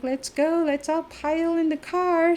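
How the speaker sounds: high, loud, eager rallying call, imitated; happy, bouncy. Sister-in-law trying to cheer everybody up, bouncy and loud. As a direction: mimic someone chivvying everyone along excitedly. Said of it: "all happy and bouncy".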